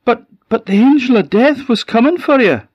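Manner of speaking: high, loud urgent plea; stammering and urgent. A narrator's reading.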